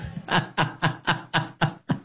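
unvoiced wheezing laugh; breathy silent laugh. Real, not acted. Host laughing at the sir line, breathless silent laugh. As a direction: laugh without voice.

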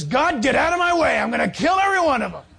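loud, high furious shout; angry Moses. Retold or imitated. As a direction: shout him out of the way. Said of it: "Moses says".

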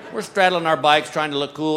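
usual speaking voice

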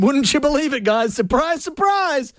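slow, high sing-song sarcasm; sarcastic. Real, not acted. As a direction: drag out the fake surprise. Sarcastic about broken computers.

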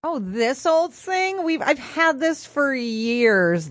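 slow, coy false modesty. Pretend. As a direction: brush it off like it's nothing. Acting out hiding the new purse.

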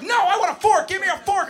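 put-on high-pitched toddler tantrum yell; screaming three-year-old. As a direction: scream a demand like a furious preschooler. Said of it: "I'm being screamed at point blank".

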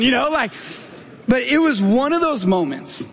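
usual speaking voice

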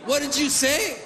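high-pitched comic confrontation; challenging duck. Retold or imitated. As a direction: question it like a puffed-up duck picking a fight. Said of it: "starts making himself look big".

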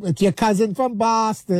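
mock Boston accent, drawn out slowly. Guy quoting a beer commercial, mock Boston accent. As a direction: stretch out a teasing imitation Boston accent. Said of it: "making fun of, like, the, the Boston accent".

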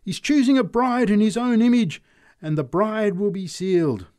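high, loud proclaiming preacher; ringing sermon voice; preaching. Acted. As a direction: proclaim it to the crowd.

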